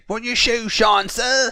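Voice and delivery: very high, loud, slow put-on street kid; shoeshine boy. Friend pretending to be a shoeshine boy, high loud put-on voice. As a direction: call out like a forties shoeshine boy. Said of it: "That'd be me".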